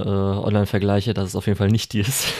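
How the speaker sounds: usual speaking voice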